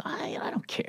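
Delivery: breathy whisper, no voice; a hushed Seinfeld brush-off. Retold or imitated. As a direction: whisper it dismissively. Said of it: "And he goes".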